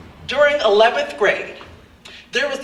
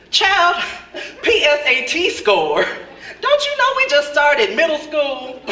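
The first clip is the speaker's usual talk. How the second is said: sassy comeback; 5 semitones higher than usual. Retold or imitated. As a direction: hit him with imagined sass. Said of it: "what I was really thinking".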